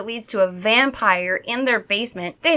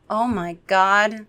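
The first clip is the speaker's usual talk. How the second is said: usual speaking voice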